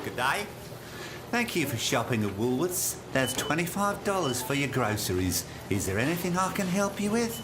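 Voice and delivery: lightly voiced polite checkout worker; a touch of a polite shop assistant; polite cashier. Checkout worker greeting a customer, lightly voiced polite service voice.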